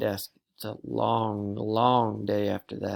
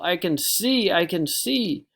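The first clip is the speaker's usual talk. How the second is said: amazed joy; 6 semitones higher, 4 dB louder, slower than usual. Acted. Amazed by new glasses.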